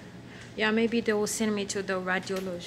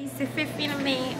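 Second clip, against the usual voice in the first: ordinary delivery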